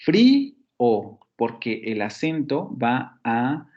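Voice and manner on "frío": Frío with the í hit high and loud; Frío with the stress on the í. Shown as a demonstration. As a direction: punch the í of Frío. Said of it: "Frío se divide con dos sílabas".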